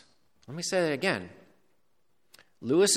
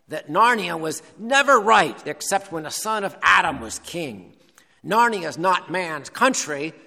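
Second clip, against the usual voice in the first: badger voice; 3 semitones higher, 4 dB louder than usual. Badger rebukes the forgetful dwarves, in a badger voice. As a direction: proclaim it as a loyal old-Narnia beast. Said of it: "gonna get my badger voice now".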